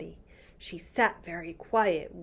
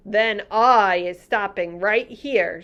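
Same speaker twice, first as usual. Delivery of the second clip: sharply; 7 dB louder than usual. Acted. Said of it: "said the B F G sharply".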